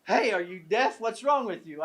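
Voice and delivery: hammed-up shout at a deaf old man; mock frog scream. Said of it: "The frog screams".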